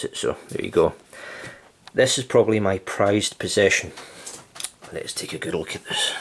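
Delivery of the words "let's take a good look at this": whispered aside. Real, not acted. Aside while showing the books.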